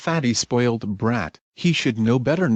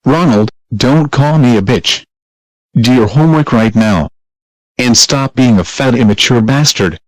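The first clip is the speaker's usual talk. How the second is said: angry yelling; 10 dB louder than usual. Acted. Mother yelling at insulting son.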